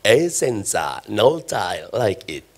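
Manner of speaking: deep, slow announcer voice; ad voiceover. Pretend. The preacher shows his crowd the tile ad voiceover, deep and slow. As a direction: croon the slogan like a TV ad. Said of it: "coba tolong dengar yang di iklan".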